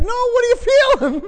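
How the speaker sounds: high, loud, eager question; acting out an urgent need to know; excited retold question. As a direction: blurt it out, pitched right up. Retelling own eager reply.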